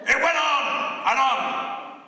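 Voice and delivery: high, loud, stretched-out cry; mock endless declaiming. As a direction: drag it out loudly, as if endless. Mimicking the endless shouted recital.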